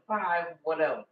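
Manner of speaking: ordinary delivery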